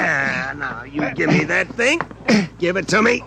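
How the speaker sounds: ordinary talk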